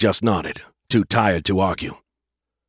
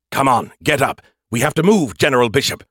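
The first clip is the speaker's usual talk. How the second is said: higher, louder, pressing urgency; urgent AI voice; 5 semitones higher, 4 dB louder than usual. Acted. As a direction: rouse him with urgent insistence. AI urgently waking the general.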